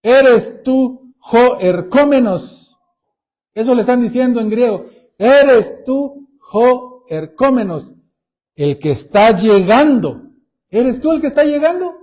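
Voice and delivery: loud, pleading question to Jesus; urgent questioning disciples. Acted. John's disciples loudly asking Jesus if he is the one coming. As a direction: call out the question with urgent hope. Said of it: "para preguntarle".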